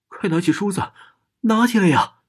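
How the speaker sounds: high, loud shrieking command from the mirror; ghost woman yelling. A narrator's reading. Mirror ghost screams at narrator to grab the comb, shrieking. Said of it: "镜中那个女人大叫道".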